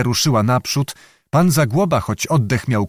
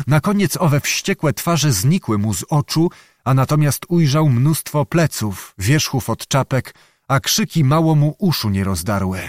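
usual speaking voice

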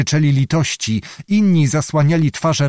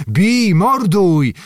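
slow drawn-out war cry, higher; battle cry; 6 semitones higher, much slower than usual. A narrator's reading. Cossacks attacking from one end, war cry. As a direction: soldiers roaring from the ravine. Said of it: "zabrzmiało przy jednym ujściu wąwozu".

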